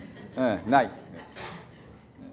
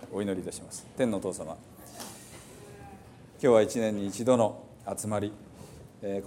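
prayer; 2 semitones lower, 4 dB quieter than usual. Acted. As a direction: pray quietly over the congregation. Leads a closing prayer. (静かに祈る牧師の声)

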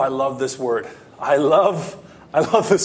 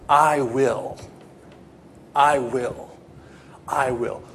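God's voice, softening; 3 semitones lower, slower than usual. Acted. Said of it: "God says".

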